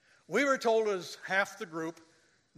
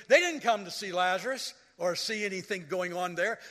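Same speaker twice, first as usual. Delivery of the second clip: normal talking voice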